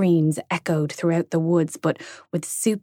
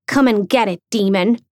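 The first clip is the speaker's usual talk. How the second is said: defiant shout; 3 semitones higher, 5 dB louder than usual. Acted. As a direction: call out the demon boldly. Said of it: "shouted Grace".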